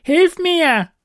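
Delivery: high, loud goblin voice; goblin cry for help. A narrator's reading. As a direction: yell for help like a trapped goblin. Trapped goblin demands help.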